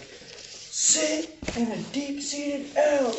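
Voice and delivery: hammed-up drill sergeant command, drawn out; mock drill sergeant yell. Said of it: "yelling the instructions at him".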